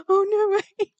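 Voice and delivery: shrieking with laughter; excited. Real, not acted. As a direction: burst out in delighted disbelief. Excited reaction to hamster.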